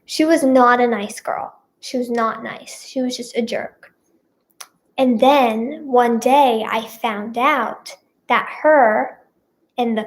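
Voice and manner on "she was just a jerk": whispered, a secret jab; whispered aside. Real, not acted. Telling viewers about the mean girl, a whispered aside. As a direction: whisper it like gossip.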